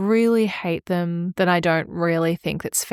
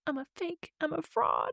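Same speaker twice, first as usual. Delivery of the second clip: mock despair; 11 semitones higher, 10 dB quieter than usual. Mock self-accusation.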